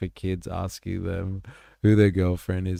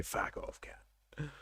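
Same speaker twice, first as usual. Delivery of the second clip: whispered Aussie fuck off; 7 semitones higher, 15 dB quieter, slower than usual. Retold or imitated. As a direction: breathe the insult out, voiceless. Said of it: "How they say".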